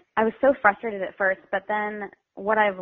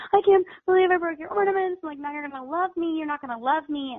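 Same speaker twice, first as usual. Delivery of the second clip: high-pitched mock breakdown; imitated tearful child; 9 semitones higher than usual. Foster child over a broken ornament, imitated tearful panic. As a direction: act out a distraught little girl, much higher. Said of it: "breaks down to tears like".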